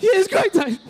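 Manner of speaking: high, loud tearful wail; hammed-up blubbering through the words; mock crying. Best man answering in the car, mock bawling. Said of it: "I'm crying like this".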